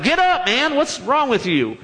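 high, impatient God; exasperated God. Retold or imitated. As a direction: tell him off, get up. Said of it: "And God's like".

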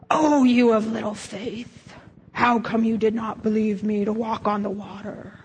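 a touch of a scolding Jesus; lightly voiced stern Jesus rebuke; frowny mad Jesus. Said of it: "the frowny face Jesus, who's mad".

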